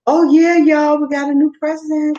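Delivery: loud and high with excitement; excited. Real, not acted. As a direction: announce it with a burst of joy. Excited announcement to audience.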